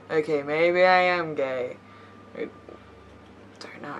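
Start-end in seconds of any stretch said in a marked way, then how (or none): none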